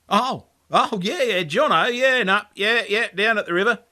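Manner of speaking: high, loud, caught-off-guard babble of yeahs; a flustered bloke stumbling over his words; flustered stammer. Retold or imitated. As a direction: fumble for an answer, stalling. Flustered narrator answering the cousin.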